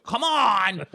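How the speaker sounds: put-on high, gruff East Coast old man. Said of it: "he had that old man voice".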